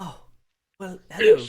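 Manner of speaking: high, loud British greeting; startled British dad greeting his daughter; British accent. Acted. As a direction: sing out hello in a posh-free British lilt. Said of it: "why do you have such an interesting accent".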